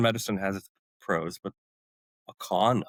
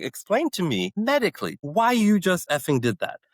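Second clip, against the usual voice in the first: raised, higher voice demanding an explanation; angry demand; 8 semitones higher, 3 dB louder than usual. Retold or imitated. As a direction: confront the nurse, indignant. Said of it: "he's angry".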